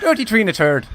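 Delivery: quick punchline take in Irish; quick Irish accent. Shown as a demonstration. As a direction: snap out "Dirty tree and a turd" in one Irish breath.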